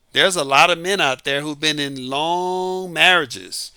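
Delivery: normal talking voice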